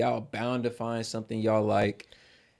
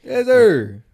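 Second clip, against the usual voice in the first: high, loud hype shouting; 12 semitones higher, 8 dB louder, much slower than usual. Real, not acted. Hosts hyping their viewers, loud high shouts. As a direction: shout it up to the crowd, pitch climbing.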